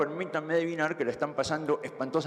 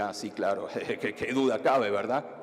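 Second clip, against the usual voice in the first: mock agreement; 7 semitones lower than usual. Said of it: "todos tienen que decir".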